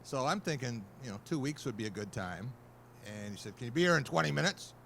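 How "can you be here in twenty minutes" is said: fast, louder rapid-fire question; brusque demand. Retold or imitated. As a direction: rush him like an impatient boss. Gruff editor pressing the caller.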